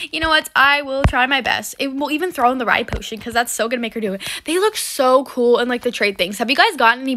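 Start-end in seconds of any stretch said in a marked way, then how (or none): none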